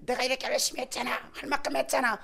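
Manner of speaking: high-pitched imitated complaint; aggrieved protest. Believer asking why stones fly, high aggrieved protest. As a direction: plead your case in indignation.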